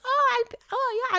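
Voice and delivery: casual like it's no big deal; janitor lady. Retold or imitated. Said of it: "she's like".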